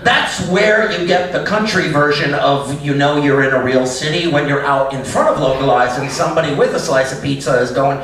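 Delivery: ordinary talk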